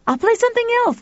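put-on very high eager boy; chirpy offer; boy's voice. Pal answers Tuffy, high boy voice. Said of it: "The boy ran up the stairs".

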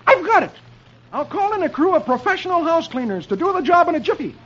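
high, excited comic exclamation; Dagwood. A narrator's reading. Dagwood hitting on a plan, high excited exclamation. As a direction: burst out with a bright idea, pitch way up. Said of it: "Dagwood exclaims".

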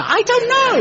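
very high, loud squeaky take; hammed-up shy shrug. Shown as a demonstration. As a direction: cushion it with a squeak. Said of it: "I cushion it softer".

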